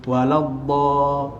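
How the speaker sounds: "Waladda-" slowly, tongue to the side teeth. Retold or imitated. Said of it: "Tak bulat".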